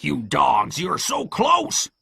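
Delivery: scolding that leaps high and slow; Pew's angry cry. A narrator's reading. Blind pirate to men about to flee, frustrated cry. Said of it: "cried Pew".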